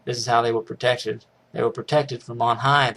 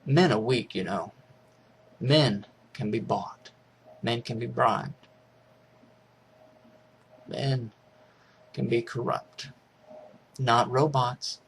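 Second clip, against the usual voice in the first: lightly voiced TV host, slow and soft; a hint of a grave pundit, slowed right down; understated slow, hushed pitchman; 4 dB quieter, slower than usual. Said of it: "way of selling it".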